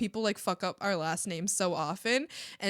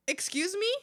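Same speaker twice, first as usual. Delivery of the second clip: a rising, disbelieving "excuse me?"; offended excuse me; 9 semitones higher than usual. Real, not acted. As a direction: raise the pitch in mock offence. Offended at name being too long.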